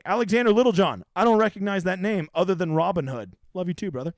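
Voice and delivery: normal talking voice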